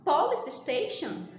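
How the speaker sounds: usual speaking voice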